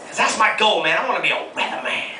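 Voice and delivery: usual speaking voice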